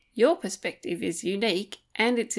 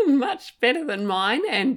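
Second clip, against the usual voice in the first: slow, laughing self-mockery; amused, self-deprecating laugh; 6 semitones higher, 4 dB louder than usual. Real, not acted. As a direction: laugh at yourself on "much better than mine". Self-deprecating joke about her words.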